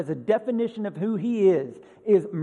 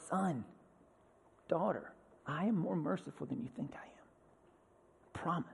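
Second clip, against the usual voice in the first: whispered, as God calling softly; 2 semitones lower, 12 dB quieter than usual. Acted. God whispering to his son and daughter, softly. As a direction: breathe it like a secret promise.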